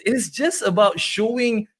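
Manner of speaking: ordinary delivery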